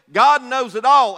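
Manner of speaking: normal talking voice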